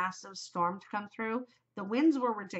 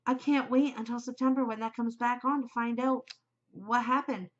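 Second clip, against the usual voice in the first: usual speaking voice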